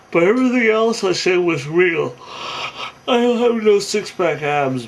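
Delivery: loud, high-pitched mock pleading; acted, over-insistent excuse; defensive protest. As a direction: protest your honesty as a caught faker. Catfish protests his honesty when caught.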